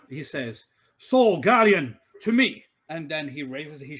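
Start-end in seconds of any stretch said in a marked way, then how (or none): 1.0-2.6 loud, high commanding summons; calling out. Acted. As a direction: call out like a hero summoning an ally. Character summons guardian in battle.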